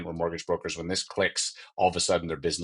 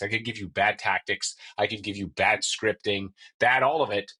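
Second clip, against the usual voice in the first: usual speaking voice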